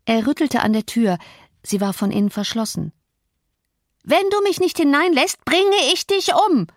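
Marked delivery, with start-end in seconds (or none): 4.0-6.7 high-pitched raging screech; frenzied shriek. A narrator's reading. Locked-out sick boy threatens at the door, shrieking. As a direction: shriek the threat in helpless fury. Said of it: "kreischte er ganz sinnlos".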